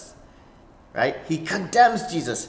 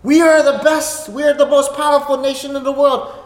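boastful national pride; 7 semitones higher, 6 dB louder than usual. Retold or imitated. Quoting American boastful self-image.

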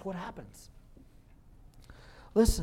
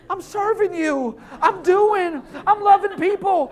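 high, loud, laughing excuse; defensive protest; 11 semitones higher, 13 dB louder than usual. Retold or imitated. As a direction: plead your case, half-laughing. Self defends to God.

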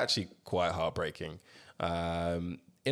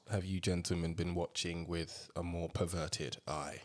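sly hushed voice; 5 semitones lower, 11 dB quieter than usual. Acted.

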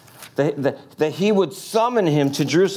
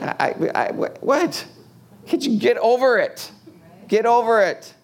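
stammering then high, pleading "get over it"; mock exasperated, voice jumping up; flustered protest; 8 semitones higher than usual. Imitating someone hurt by another's grudge, exasperated.

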